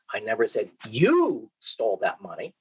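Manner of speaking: "you" rises and carries the weight; stress on YOU. Shown as a demonstration. Lecturer showing students emphasis on "you". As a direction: point at "you" as if someone else did it. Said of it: "depending on where I put the emphasis".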